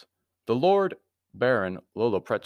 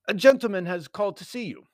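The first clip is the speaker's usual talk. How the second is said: high, louder clerk's voice; desk clerk; 12 semitones higher, 5 dB louder than usual. A narrator's reading. Clerk announcing a visitor by telephone, high role voice. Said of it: "The voice of the desk clerk".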